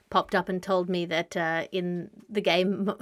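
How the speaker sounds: ordinary delivery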